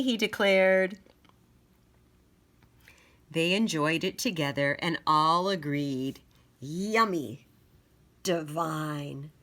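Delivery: put-on bright, loud read-aloud to children; lively picture-book narration; storybook reading. A narrator's reading. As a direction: read it out big for the little ones.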